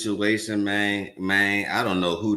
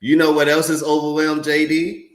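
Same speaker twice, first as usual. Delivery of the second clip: higher, louder taunt; loud mocking interjection; 7 semitones higher, 7 dB louder than usual. Real, not acted. Streamer loudly taunting the senator on screen. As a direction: shout it over the clip. Streamer talks over debate clip.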